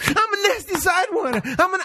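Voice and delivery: a hammed-up high voice repeating a dirty line; imitating her eager reply. Man mimics the woman parroting his words in a high voice. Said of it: "She goes".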